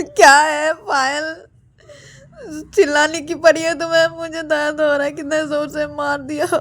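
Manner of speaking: high, tearful acted complaint; pained whining. Ananya complains to Payal that it hurts, pained whining. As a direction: whine loudly through the hurt. Hit character complains of pain.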